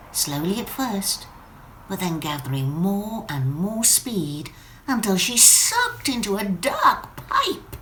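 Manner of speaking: plain reading voice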